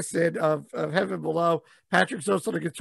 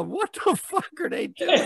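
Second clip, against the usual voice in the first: baffled onlooker; 7 semitones higher than usual. Acted. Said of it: "get there early go".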